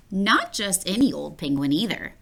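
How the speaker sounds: ordinary delivery, nothing marked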